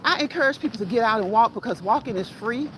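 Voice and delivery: usual speaking voice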